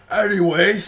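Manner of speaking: loud, high call back to the crowd; goblin chief resuming speech. Acted. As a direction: boom it out to regain the crowd. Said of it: "he's like".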